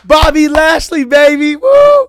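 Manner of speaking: screaming fan hype building to a whoop; hype shout. Real, not acted. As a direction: cheer him on like a ringside fan. Hyping his favourite wrestler.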